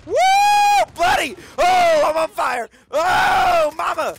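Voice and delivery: very loud high yell; hype shout. Real, not acted. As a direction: scream on a streak. Hype during streak.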